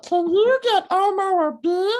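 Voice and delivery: squeaky Elmo voice politely asking for a beer; Elmo impression. Shown as a demonstration. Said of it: "impression of Elmo asking a child to get a beer".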